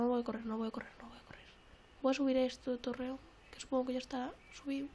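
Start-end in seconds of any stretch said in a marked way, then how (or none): 0.0-1.5 fast, hushed whisper to herself; tense whisper. Real, not acted. Hiding in the game, fast tense whisper. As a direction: whisper quickly so nobody hears.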